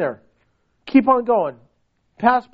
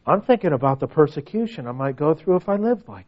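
low-pitched worried admission; reluctant listener; 7 semitones lower than usual. Acted. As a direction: play a churchgoer confessing reluctance, pitched low. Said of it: "or could you say".

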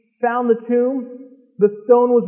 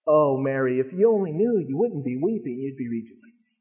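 tender angel voice; 7 semitones lower, 4 dB quieter than usual. Acted. Angels voiced gently to Mary.

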